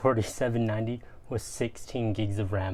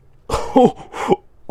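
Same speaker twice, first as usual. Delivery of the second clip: excited laugh; 12 semitones higher, 12 dB louder than usual. Real, not acted. Opening laptops, bursts out laughing.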